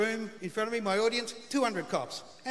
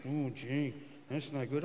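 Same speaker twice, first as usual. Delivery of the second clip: "that's no good" in a low, quieter cop voice; gruff cop voice; 8 semitones lower, 7 dB quieter, slower than usual. Retold or imitated. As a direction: play the unimpressed policeman, low and dry. Cop voiced in retold story.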